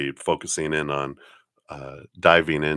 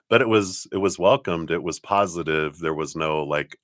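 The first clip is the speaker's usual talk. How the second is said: ordinary talk, nothing marked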